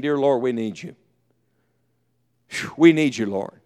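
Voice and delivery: soft, low, dependent plea; humble prayer. Acted. Disciples praying together in the upper room, humbly hushed. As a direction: pray it quietly, dropping away at the end.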